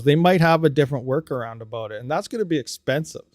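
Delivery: ordinary delivery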